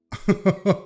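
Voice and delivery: high, loud laugh; real burst of laughter. Real, not acted. Cracking up at last meme.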